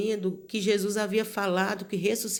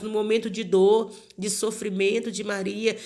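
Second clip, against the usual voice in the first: ordinary talk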